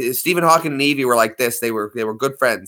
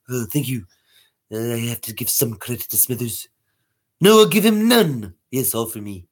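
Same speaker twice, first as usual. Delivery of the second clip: Mister Burns; 2 semitones lower than usual. Acted. Refusing to give Smithers credit, scheming boss voice. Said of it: "Mister Burns".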